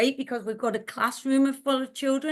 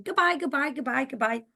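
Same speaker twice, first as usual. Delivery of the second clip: put-on children's voices; 3 semitones higher, faster than usual. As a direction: toss out goodbyes like many children. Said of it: "lots of children saying".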